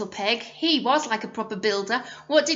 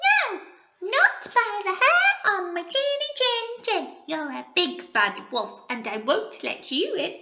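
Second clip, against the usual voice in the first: much higher, sing-song little pig; 9 semitones higher than usual. Acted. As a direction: chant the refusal like a tiny pig. Pig answers the wolf.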